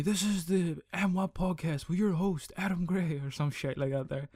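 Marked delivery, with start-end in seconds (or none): 0.0-3.2 slow, higher announcer voice, drawn out; announcer intro voice. Pretend. Host introducing himself in a slow, higher announcer voice. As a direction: boom it out like a podcast announcer. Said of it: "my introduction to me".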